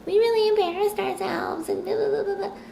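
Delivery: mock the smug kid in a silly high voice; sneering at the smug boy, squeaky parody. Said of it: "Who is he to come in and be like".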